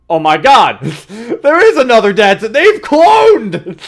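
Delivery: high, very loud real exclamation with laughter; laughing shriek of amazement; shocked outburst. Real, not acted. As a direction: yell it in hysterical disbelief. Shock at a plot twist.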